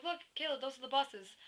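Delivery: mildly excited call to look; excited pointing out. Retold or imitated. Retelling spotting the buses to friend.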